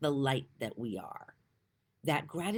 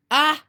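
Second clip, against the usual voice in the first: a loud, high breaking sigh before tears; emotional sigh; 12 semitones higher, 14 dB louder than usual. Real, not acted. Moved by her own message, loud choked sigh. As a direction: let the breath catch on a sob. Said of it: "I'm gonna cry".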